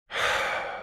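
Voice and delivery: long, soft exhale; weary sigh under pressure; heavy sigh. Acted. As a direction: breathe out slowly, no voice. Stressed by the detective's questions.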